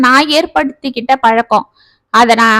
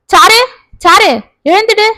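loud, higher, stern call to wake her; angry father shaking his daughter awake; 7 semitones higher, 3 dB louder than usual. A narrator's reading. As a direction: snap her name to rouse her. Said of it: "நிஜமாகவே கோபம் வந்துவிட்டது".

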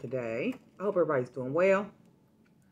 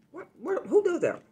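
disbelief; 11 semitones higher, 4 dB louder than usual. Real, not acted. Outraged disbelief at scam.

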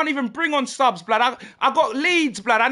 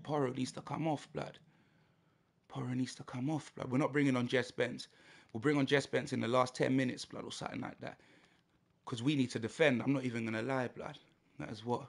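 very quiet, low, fast mumble; muttering tactics; 13 semitones lower, 15 dB quieter, much faster than usual. Real, not acted. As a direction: think aloud under the breath, quickly. Talking tactics to himself.

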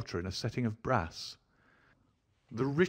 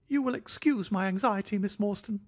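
light, offhand, much higher thin voice; airy; 11 semitones higher than usual. A narrator's reading. Host airily apologizing to Miss Morstan in a high thin voice. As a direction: toss it off as if nothing mattered. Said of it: "he remarked airily".

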